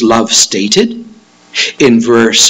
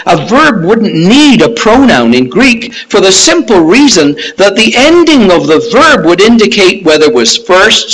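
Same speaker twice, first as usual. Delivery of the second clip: ordinary talk, nothing marked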